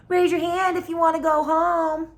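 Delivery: put-on high, loud child's yell. A narrator's reading. Child yells to the class before reading turn, loud high shout. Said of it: "I yelled".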